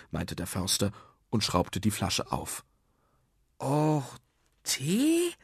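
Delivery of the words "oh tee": high-pitched letdown, questioning; disappointed. Acted. Children learn the treasure is tea, disappointed. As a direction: rise high in disappointed disbelief. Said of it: "riefen die Kinder enttäuscht".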